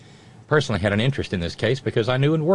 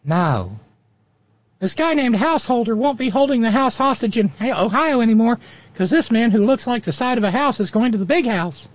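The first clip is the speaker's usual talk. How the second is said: put-on squeaky, much higher little boy voice; cheeky kid puppet, pitched way up; 13 semitones higher, 3 dB louder than usual. Puppet Junior's long turn.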